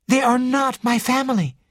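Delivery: high, proud denial. A narrator's reading. Ginevra disowns her family to detective, proudly. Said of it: "Ginevra said proudly".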